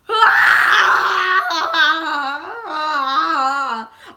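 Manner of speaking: very long, loud, high mock wail; full-blast exaggerated bawling; demonstrated hysterical wailing. Shown as a demonstration. Showing her crying level for Spider-Man, hysterical wailing. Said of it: "my crying level. I'll show you".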